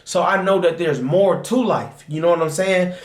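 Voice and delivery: ordinary talk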